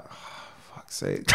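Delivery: very quiet, breathy aside, barely voiced; retold exasperation. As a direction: sigh it out under your breath, low. Retold dismayed reaction to the call.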